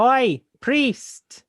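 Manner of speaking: high, loud call from down the hill; loud call to the priest; shouting. Acted. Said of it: "I shout from down the hill".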